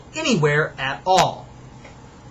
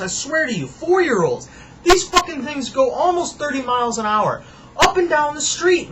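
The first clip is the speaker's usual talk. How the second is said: much higher, incredulous ranting; exasperated rant; 8 semitones higher than usual. Acted. Neighbor ranting about kids on motorbikes, high and incredulous. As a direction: voice shooting up in disbelief.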